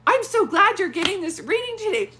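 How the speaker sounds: high, loud and gushing; delighted. Real, not acted. Delighted for the client.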